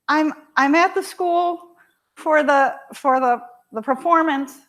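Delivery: high, loud flustered daughter; upset daughter. Retold or imitated. Daughter at the wrong school, flustered and pitched high. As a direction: stammer it out like a flustered teen. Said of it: "she's really upset".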